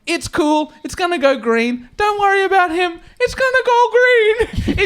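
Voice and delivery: high, light, easy voice; mate calmly insisting it will go green; unbothered reassurance. Retold or imitated. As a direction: soothe him as the chaos rages. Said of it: "my guy Dave is just like".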